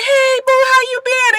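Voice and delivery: high sweet mock greeting; Shonda greeting. Wife greeting Screwface at the studio, high and sweet. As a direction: coo a hello. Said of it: "and she's just like".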